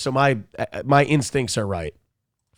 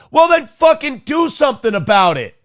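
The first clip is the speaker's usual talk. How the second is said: angry shout; 10 semitones higher than usual. Retold or imitated. Ranting at a congressman about lip service, angry shout. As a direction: yell at him to act.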